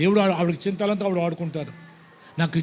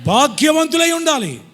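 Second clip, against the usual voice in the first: preaching shout; 9 semitones higher, 7 dB louder than usual. Real, not acted. Preaching climax.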